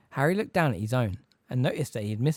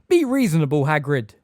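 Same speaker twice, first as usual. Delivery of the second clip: loud urgent shout; yelled; 3 semitones higher, 9 dB louder than usual. A narrator's reading. Wizard urging Hagrid to stop fighting, loud yell. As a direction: shout across at a raging giant. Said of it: "A man yelled".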